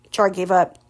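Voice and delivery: usual speaking voice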